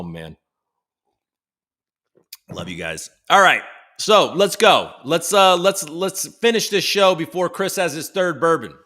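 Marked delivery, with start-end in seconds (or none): 3.3-8.7 loud hype to get going; louder, rallying the stream; energetic restart. Real, not acted. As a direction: pump up the chat and move on.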